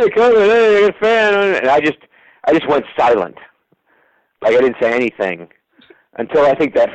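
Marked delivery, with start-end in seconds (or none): 0.0-1.5 high, loud call over a stall door; excited call to a hiding friend; calling through a door. Retold or imitated. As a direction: shout it at a closed door, eager.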